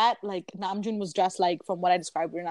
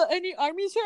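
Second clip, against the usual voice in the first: high, mocking fan voice; mocking commenter; 10 semitones higher than usual. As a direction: parody a clueless fan.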